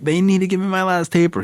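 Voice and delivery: frantic pleading. Retold or imitated. Begging the guard in detox.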